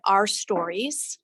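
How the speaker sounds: ordinary delivery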